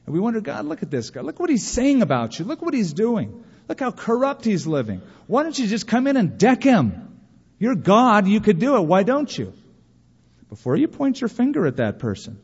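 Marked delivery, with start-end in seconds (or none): 0.4-9.5 indignant complaint to God. Retold or imitated. Believer protesting to God about a sinner, indignant and rising. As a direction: demand God step in and deck the sinner.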